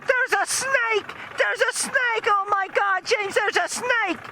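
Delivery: very high-pitched screeching wife; imitated hysterical mother, pitched sky-high; mock panicked scream. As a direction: shriek it in falsetto, hammed-up terror. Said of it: "this blood-curdling scream came from the back of the car".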